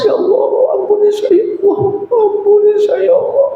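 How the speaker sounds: high pleading cry to God; tearful prayer. Retold or imitated. Preacher acts a weeping sinner pleading for forgiveness. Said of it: "banyak menangis".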